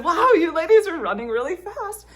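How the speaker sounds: giddy laughing exclamation. Retold or imitated. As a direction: burst out laughing through the words. Laughing recalling breathless run.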